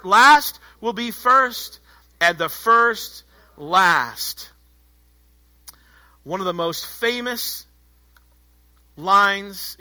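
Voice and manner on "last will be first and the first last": very slow, deliberate phrases with pauses; emphatic, drawn-out call to join in; recitation for group. Real, not acted. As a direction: lead a crowd in reciting, word by weighty word. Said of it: "Say it with me".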